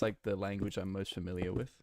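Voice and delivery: ordinary talk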